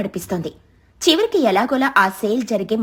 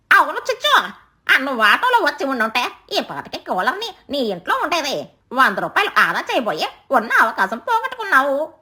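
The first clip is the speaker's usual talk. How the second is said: animated scolding bird; chiding bird friend; 3 semitones higher than usual. Acted. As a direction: rub in the lost chance with exaggerated lilts. Bird chides crow in sing-song.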